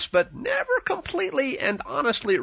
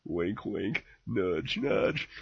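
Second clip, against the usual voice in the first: conspiratorial hush; 12 semitones lower, 10 dB quieter, much slower than usual. Acted. Sarcastic insinuation, dropped low.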